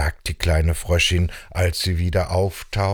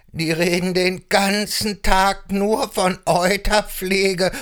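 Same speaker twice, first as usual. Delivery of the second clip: higher, wavering goat complaint; put-upon billy goat; 11 semitones higher than usual. Acted. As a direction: lament like a harried billy goat. Ziegenbock klagt weiter. (klagender Ziegenbock mit Meckerstimme)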